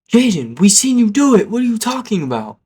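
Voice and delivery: high, loud group outcry; outraged accusation. Retold or imitated. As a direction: call him out like indignant classmates. Classmates loudly accusing culprit.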